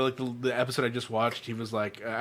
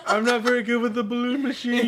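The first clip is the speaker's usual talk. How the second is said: laughing mock line; 11 semitones higher, 5 dB louder than usual. Laughing movie quote.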